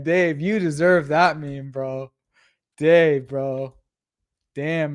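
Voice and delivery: normal talking voice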